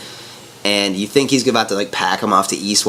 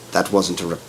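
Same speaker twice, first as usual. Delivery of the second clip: lower, softer menacing try; intimidating voice attempt; 6 semitones lower, 6 dB quieter than usual. Shown as a demonstration. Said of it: "I can try, like, right now".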